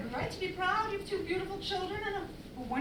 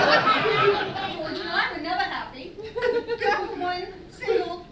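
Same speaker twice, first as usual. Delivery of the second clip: louder and slower, laughter turning to sobs; laughing into tears; 8 dB louder, slower than usual. Acted. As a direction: let the laugh crack into crying. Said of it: "I haven't been cried since I was fourteen".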